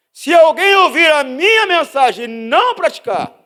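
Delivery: high, loud, slow scripture cry; booming verse from the pulpit; scripture proclamation. Acted. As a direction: thunder the verse out slowly.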